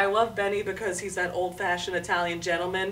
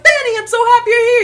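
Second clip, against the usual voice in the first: high, loud, gushing hello; excited shouted greeting; 15 dB louder, faster than usual. Acted. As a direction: shout a quick, overjoyed greeting. Acts out greeting an older Italian man.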